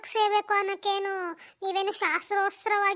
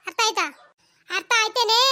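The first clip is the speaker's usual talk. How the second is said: louder, demanding answer; stern warning; 2 semitones higher, 7 dB louder than usual. Acted. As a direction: bark the warning at her. Mother-in-law warns new bride. (ಅತ್ತೆಯ ಗದರಿಕೆ)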